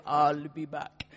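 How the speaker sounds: low, very slow, badly faked Austrian accent; Arnold Schwarzenegger. Preacher joking about Jesus's return, bad Austrian-accent impression. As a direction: do a hammy action-hero Austrian accent, drawn out. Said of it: "Arnold Schwarzenegger type way".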